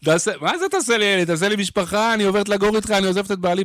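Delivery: lightly voiced besotted groupie; mock infatuated woman offering herself; smitten woman fan.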